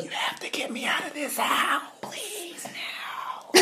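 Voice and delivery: high, rising into whisper; beg, fading to a whisper; desperate child plea. Retold or imitated. Child begging to be rescued.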